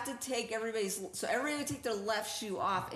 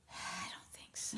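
whisper; 13 dB quieter than usual. Real, not acted. Doubtful reply about readiness.

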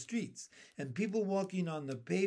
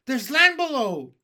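much higher and louder centipede shout; excited shout; 8 semitones higher, 13 dB louder than usual. A narrator's reading. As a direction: shout out a thrilling discovery.

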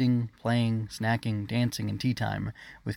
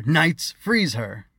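loud, raised order; commanding shout; 5 semitones higher, 7 dB louder, much slower than usual. Acted. Usurper pointing at queen, loud command to knights. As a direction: command the guards sharply.

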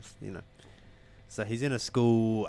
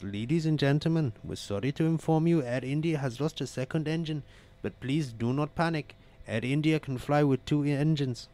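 put-on higher-pitched Indian-accented cabin announcement; 6 semitones higher, 4 dB louder than usual. Airline announcer calming passengers after engine loss, Indian accent. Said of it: "excuse me for my Indian accent".

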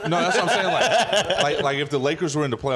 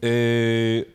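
ordinary delivery

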